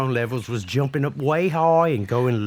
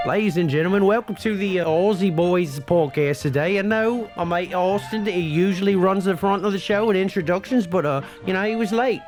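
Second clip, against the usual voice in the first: upbeat show opener in a mock Aussie accent, higher; podcast host intro; 4 semitones higher than usual. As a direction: welcome the listeners like a cheery Aussie host. Podcast intro over music.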